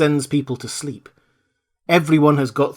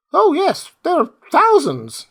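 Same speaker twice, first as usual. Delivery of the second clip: mouse queen; 5 dB louder than usual. Acted. Queen tells her mice who saved her, squeaky. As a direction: squeak with royal dignity.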